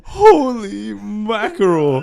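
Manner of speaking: real slow stunned exclaim; astonished. Real, not acted. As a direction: stretch it out in disbelief. Reaction to airball story.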